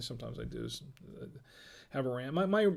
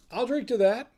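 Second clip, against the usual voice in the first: raised, louder toast with an upward lift; cheery toast; 7 semitones higher, 5 dB louder than usual. Acted. Raising a toast to a viewer's pasta-day joke, bright and lifted. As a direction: raise a glass to the chat.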